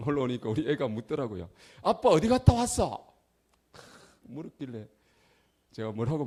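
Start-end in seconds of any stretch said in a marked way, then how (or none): 1.8-3.2 put-on high, loud child's voice; child greeting dad, high and loud; child's question. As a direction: ask like a small child. Child asks father.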